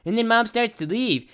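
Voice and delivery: ordinary talk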